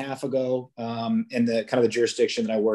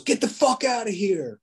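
imitated angry yell; 9 semitones higher than usual. UPS driver cursing at an officer from his truck, imitated yell. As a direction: act out an irate driver yelling. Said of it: "he yells at me from across the street".

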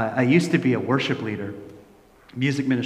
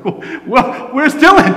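high, loud panicked question, re-enacted; alarmed cry; 12 semitones higher, 10 dB louder, much slower than usual. Family waiting for cab notices son missing, alarmed shout. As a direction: call out in sudden alarm.

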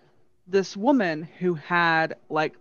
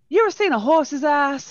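higher-pitched imitated Southern Belle; Southern Belle accent; 7 semitones higher, 4 dB louder than usual. Wronged wife to hotel clerk, lightly imitated Southern Belle accent. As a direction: throw shade as a mock Southern Belle. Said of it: "that pure Southern Belle accent".

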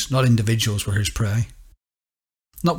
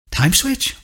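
"Time switch?" much higher with a rising question on "switch"; puzzled echo; 8 semitones higher than usual. A narrator's reading. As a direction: echo the words back in disbelief. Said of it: "Bonnie echoed".

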